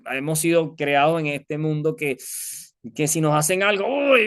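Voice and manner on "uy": high, loud burst of indignation; sharp, high indignant outburst; sudden flare-up. Acted. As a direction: flare up as if provoked. Reacting when someone wrongs us.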